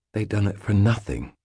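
plain reading voice

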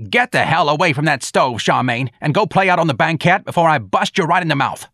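high, loud scolding yell; screamed threat. Acted. Santa screaming at her grandchild by the stove, furious. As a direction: holler a threat across the house. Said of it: "screamed at someone at her end".

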